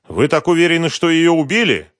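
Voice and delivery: loud, high-pitched questioning; urgent challenge. A narrator's reading. Husband pressing the expert about his wife's death, urgent.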